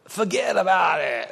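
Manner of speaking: lightly Brooklyn "Forget about it"; a hint of Brooklyn, shrugging it off. Pastor brushing off an offense, light Brooklyn accent. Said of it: "It's a Brooklyn version".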